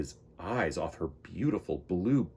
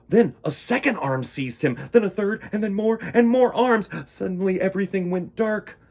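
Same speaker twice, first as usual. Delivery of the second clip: rising higher and louder with alarm; building tension; 9 semitones higher, 7 dB louder than usual. A narrator's reading. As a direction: build the panic line by line.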